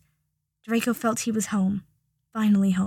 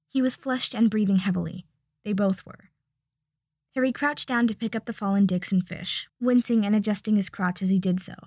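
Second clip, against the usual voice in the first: ordinary delivery, nothing marked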